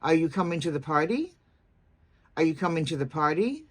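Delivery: yes-no question rising on "party"; brisk question, "party" swinging upward; rising intonation. Shown as a demonstration. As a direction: lift the pitch at the end on "party". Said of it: "you would go up in pitch at the end".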